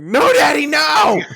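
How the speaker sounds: high, loud, hammed-up pleading; mock scream. Victim begs as his chest is stomped, mock screaming. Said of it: "You're just screaming something like".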